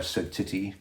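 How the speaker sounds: usual speaking voice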